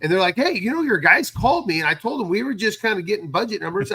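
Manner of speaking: usual speaking voice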